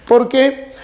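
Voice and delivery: ordinary talk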